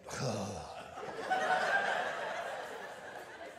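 long, mock-exasperated sigh; acted wordless huff of exasperation. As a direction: heave out an imitation fed-up sigh. Acting out wife's exasperated reaction.

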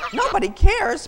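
loud, high indignant echo; loud squawked disbelief; outraged balking. A narrator's reading. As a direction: throw his words back in outrage. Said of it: "balked Matilda".

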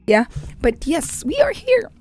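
usual speaking voice

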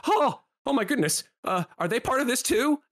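high, flustered little voice; startled halfling. Acted. Halfling spotting strangers in the alley, startled and high. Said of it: "he looks at you and he goes".

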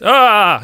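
sudden loud high yelp; startled cry. Real, not acted. Startled by a pig appearing, loud yelp. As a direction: jump at the surprise.

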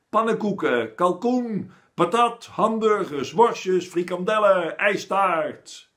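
high, loud shouted list of foods; kids yelling. A narrator's reading. Whole class yelling food wishes at the teacher, loud and high. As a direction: shout them out like a rowdy class. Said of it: "brult iedereen door elkaar".

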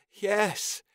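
high, sharp cry of yes; desperate yes. Acted. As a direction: blurt it out in need. Tyon desperate answer.

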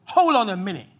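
bang on the door and demand; mob yelling. Retold or imitated. Baying crowd at Lot's door, loud demanding shout. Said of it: "And the crowd was like".

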